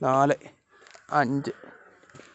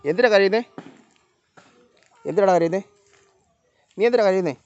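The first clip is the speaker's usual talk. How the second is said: raised-voice questioning of an animal; loud, higher chiding question; scolding a crying pet; 5 semitones higher, 6 dB louder than usual. Real, not acted. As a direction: ask the pet loudly, pitched up. Asking the crying animal why.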